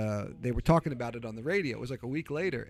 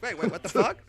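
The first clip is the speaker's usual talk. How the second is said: higher, laughing what-the-fuck; startled and half laughing; retelling his own stunned reaction with a laugh; shocked disbelief; 6 semitones higher, 5 dB louder than usual. Retold or imitated. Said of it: "I'm like".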